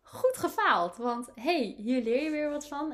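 high, loud, cheering self-praise; congratulating herself. Acted. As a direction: cheer yourself on like a proud coach. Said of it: "dat ik mezelf bijna kan feliciteren".

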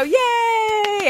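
high, drawn-out yay; mock celebratory cheer; group cheer. Shown as a demonstration. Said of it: "then we all go".

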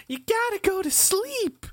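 put-on very high, little-girl cat voice; high-pitched impression of a small cat character; Morgana. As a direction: squeak it like a bossy cartoon cat. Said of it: "sound like a little girl who's a little boy cat".

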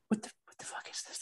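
exasperated whisper. Real, not acted. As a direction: hiss it under your breath. Exasperated aside about Ferrari.

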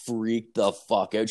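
ordinary talk, nothing marked

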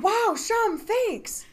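high, loud fake thanks; mock-grateful exclaim. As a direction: gush out a sarcastic thank-you. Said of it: "And I said".

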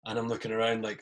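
ordinary talk

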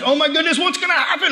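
high-pitched mock panic; retold panic, pitch jumping up; freaking out. Son fearing for his missing dad, freaking out. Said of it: "I'm freaking out".

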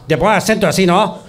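a lightly hammed Basque accent demo; exaggerated Basque accent. Shown as a demonstration. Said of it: "acento tan típico que hacíamos".

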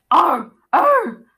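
two loud, high baboon barks; loud high-pitched ape call; baboon cry. A narrator's reading. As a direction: screech out short, sharp animal cries. Narrator voices Khufu the baboon's cries.

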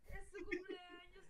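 mock crying. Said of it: "él lloró en mis quince años".

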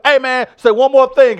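high, loud imitation of a dare; challenging teacher. Teacher daring a student to talk back, challenging. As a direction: throw down the dare as a mock teacher.

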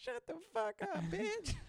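very high, loud squeal of insult; imitated mouthy kid. Mimics the student from the video.

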